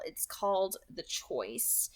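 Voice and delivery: low, slow and soft, letting the title land; dramatic title reading. A narrator's reading. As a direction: announce the chapter name slowly and hushed.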